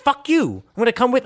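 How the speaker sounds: high defiant retort. Retold or imitated. Azalea answering the party that rejects her, high and defiant. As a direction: toss it back high and cocky.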